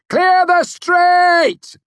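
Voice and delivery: very high, loud, slow holler; shouted warning. A narrator's reading. Hero shouting down from the window, loud warning. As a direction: bellow each word slowly to those below. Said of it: "I shouted down".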